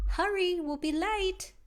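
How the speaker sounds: high, loud hurry-up call; hurried call. Acted. Meg rushing Owl off to school, calling high and loud. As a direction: hurry him out of the door.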